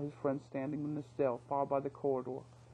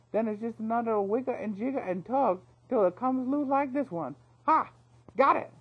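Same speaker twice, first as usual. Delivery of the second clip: higher, louder chirpy voice with effort grunts; thief grunting with concentration; 4 semitones higher, 7 dB louder than usual. Acted. Thief on friend's shoulders picking bolts, louder with grunts. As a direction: boast while working the lock. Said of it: "punctuated by odd grunts of concentration".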